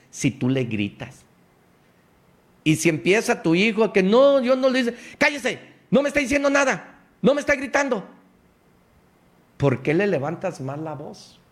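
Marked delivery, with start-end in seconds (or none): 4.0-5.0 put-on high, louder protesting kid voice; whiny child denial. A son denying to his yelling father, in a high whiny voice. Child denies to scolding parent. 5.2-8.1 high, fast hammed-up scolding; mock parent scolding. A father shouting down his son, in a high hammed-up scold. Parent yells back at child.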